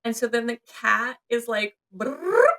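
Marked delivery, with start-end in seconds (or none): 1.9-2.4 rolling brrr noise up high; high rolled purr. Retold or imitated. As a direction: purr like a cat bus. Cat bus purr sound.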